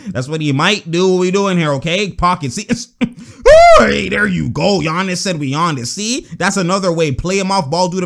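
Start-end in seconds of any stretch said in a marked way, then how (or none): 2.9-3.7 loud, very high whoop; excited shriek. Real, not acted. Commentator seeing the play come off, loud excited shriek. Reacting to a pocket pass play.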